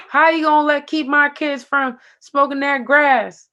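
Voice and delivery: loud, high, drawn-out Southern mom; Southern mom accent. Acted. Mom confronting the presenter at a community meeting, mock Southern accent. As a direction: challenge them loudly in a slow, high-pitched Southern accent. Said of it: "I don't know why they have that Southern accent".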